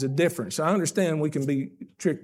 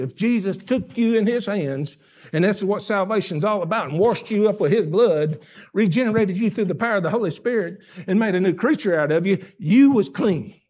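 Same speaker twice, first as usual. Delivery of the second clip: preacher rising to full force; preaching climax; 4 semitones higher, 4 dB louder than usual. Acted. Preaching builds to peak.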